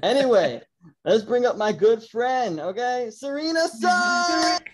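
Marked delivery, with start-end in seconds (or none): 3.1-4.6 name drawn out, slow and high; big show-host guest intro; announcer introduction. Real, not acted. Host brings the good friend on the podcast, drawn-out announcer call.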